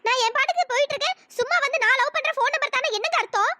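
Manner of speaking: high-pitched, affronted scolding; exasperated, pitch climbing high; indignant protest. Acted. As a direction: push back at a pestering suitor. Girl protests at pestering suitor.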